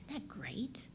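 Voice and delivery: excited little whisper; breathy aside. Real, not acted. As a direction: whisper it like a secret. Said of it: "aside to listeners".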